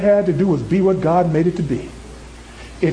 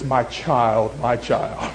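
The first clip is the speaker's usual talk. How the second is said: low, softening, tender fatherly address; loving voice; 9 semitones lower, 5 dB quieter, much slower than usual. Acted. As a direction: lower the voice and speak lovingly. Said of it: "in a loving voice".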